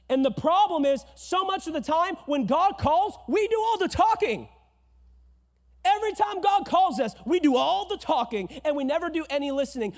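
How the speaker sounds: loud, high fiery sermon; full-force preaching. Real, not acted. Pastor rousing the congregation, fiery and loud.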